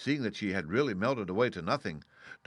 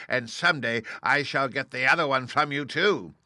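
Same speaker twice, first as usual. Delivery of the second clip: high, loud, gloating witch voice; mocking witch; 5 semitones higher, 8 dB louder than usual. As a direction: sneer a threat at the girl. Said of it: "laughing at her".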